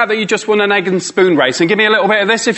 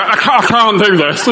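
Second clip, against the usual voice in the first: laughing protest. Retold or imitated. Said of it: "people looking at me going".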